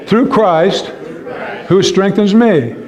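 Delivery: rising high, ringing confession of faith; leading the church in spoken declaration; declaring scripture. Shown as a demonstration. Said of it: "do this with me, speak this".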